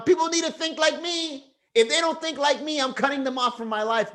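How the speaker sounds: high, mocking self-righteous voice; mocking a know-it-all. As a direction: sneer it like a smug zealot. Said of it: "You're just walking around".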